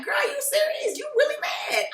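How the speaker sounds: high-pitched imitated disbelief; incredulous. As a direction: question it high, as if it can't be real. Acting Portia's disbelief at the anger.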